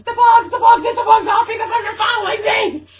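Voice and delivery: very high, very loud screaming; panicked screaming. Retold or imitated. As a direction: shriek it as if bugs are all over you.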